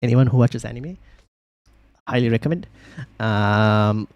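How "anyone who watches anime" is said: usual speaking voice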